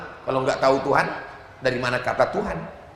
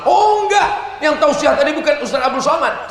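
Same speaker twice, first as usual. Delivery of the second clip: sharp high exclaimed no, much higher and louder; sudden correction; 9 semitones higher, 4 dB louder than usual. Acted. Preacher catches his own joke and shouts a correction, much higher and louder. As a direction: burst out with a correction.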